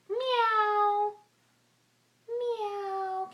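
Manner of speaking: long, high kitty meow; high-pitched cat imitation. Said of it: "What do kitties say?".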